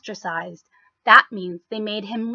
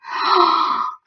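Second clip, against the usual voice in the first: sharp gasp; 6 semitones higher than usual. Acted. Townsfolk see the giant.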